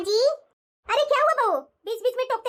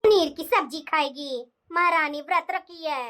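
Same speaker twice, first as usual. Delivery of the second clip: slow mocking mutter; 6 semitones lower, slower than usual. Bahu mutters mocking mother-in-law.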